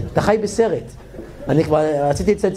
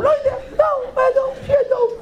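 squeaky laughing reply. Retold or imitated.

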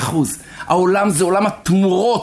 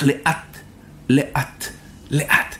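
slow; 4 semitones lower, 4 dB quieter, much slower than usual. A narrator's reading. The German walking slowly along rows.

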